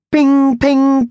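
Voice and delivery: high loud ping imitation; lift door ping. A narrator's reading. Lift doors pinging as Howard tries escaping, imitated ping. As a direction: sing out the lift bell.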